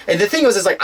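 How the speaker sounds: ordinary talk, nothing marked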